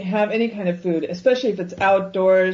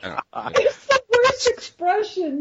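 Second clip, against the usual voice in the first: laughing outburst; 12 semitones higher, slower than usual. Real, not acted. Laughing rant about waiters.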